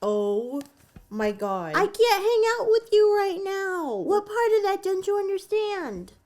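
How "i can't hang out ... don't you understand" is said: much higher, put-on cat voice; voicing the cat. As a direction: speak for the pet in a squeaky pretend voice.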